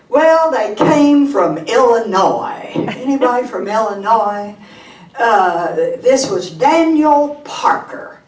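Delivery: normal talking voice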